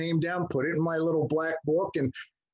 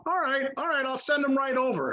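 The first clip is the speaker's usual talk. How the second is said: higher-pitched, offhand agreement; lightly acted, raised-pitch reply; imitated phone reply; 8 semitones higher than usual. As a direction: mimic a busy man agreeing on the phone. Retold friend giving in by phone.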